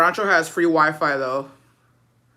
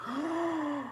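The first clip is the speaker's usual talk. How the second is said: a sharp, high, soft gasp; 9 semitones higher, 12 dB quieter than usual. Real, not acted. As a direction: gasp in mock shock. Reacting to the comment.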